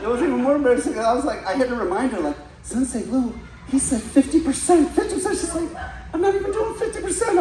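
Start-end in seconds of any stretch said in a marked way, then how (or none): 2.6-5.4 high-pitched, protesting to the instructor; pleading complaint. Retold or imitated. Student complaining to sensei about the child's kicks, high pleading complaint. 6.1-7.4 put-on very high child's voice; little girl. Eight-year-old girl answering her battered sparring partner, high child voice.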